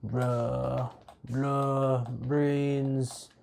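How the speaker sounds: groaning zombie moaning for brains. Pretend. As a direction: drag it out like a hungry zombie. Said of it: "you all can enjoy me making some zombie sounds".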